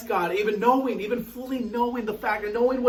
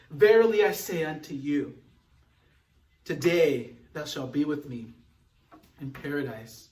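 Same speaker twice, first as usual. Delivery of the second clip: solemn promise; 4 semitones lower than usual. Acted. Quoting Jesus, soft and low.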